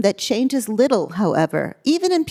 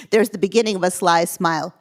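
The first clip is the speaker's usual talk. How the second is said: plain reading voice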